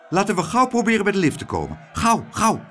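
high, fast urging; quick high-pitched pressing plea; urgent hurrying. A narrator's reading. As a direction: hurry them along anxiously. Klaterhoen urges a hurried escape.

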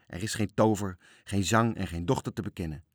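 ordinary delivery, nothing marked

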